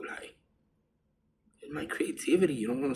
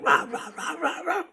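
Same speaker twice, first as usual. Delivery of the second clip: very high, yappy mock barks; imitated dog bark; 4 dB louder than usual. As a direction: yap like a small angry dog. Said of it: "he's barking at me".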